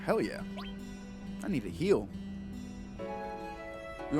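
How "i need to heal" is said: ordinary delivery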